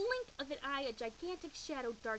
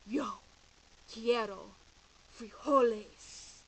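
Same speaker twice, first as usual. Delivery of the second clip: much louder, slow and solemn; somber voice; 10 dB louder, much slower than usual. Acted. Don Diego declaring to Skipito, somber and solemn. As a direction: declare it like a grave decree. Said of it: "In his most somber voice".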